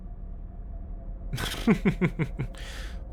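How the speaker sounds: soft, breath-led chuckle; quiet run of amused snickers; dismissive chuckle. Acted. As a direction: laugh it off under your breath. Scoffs at being called creep.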